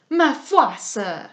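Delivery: put-on lightly French-accented retort; French-accented exclamation; foreign accent. A narrator's reading. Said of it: "There was something foreign in her accent".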